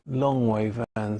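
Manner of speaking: usual speaking voice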